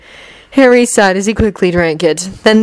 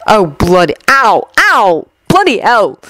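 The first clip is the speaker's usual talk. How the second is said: yelping ows with a jump in pitch; acted cry of pain; pained exclamation; slower than usual. A narrator's reading. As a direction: exclaim as if just smacked on the head. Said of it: "Harry exclaimed".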